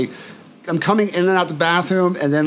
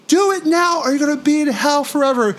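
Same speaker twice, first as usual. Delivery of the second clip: high, urgent cosmic voice ordering him; a booming higher voice of the universe; universe's command; 11 semitones higher than usual. Retold or imitated. The universe orders him out of hell, high urgent voice.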